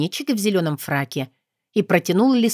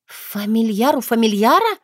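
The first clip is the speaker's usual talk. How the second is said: surprised gasp; 3 semitones higher than usual. Acted. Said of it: "удивлённо охнула я".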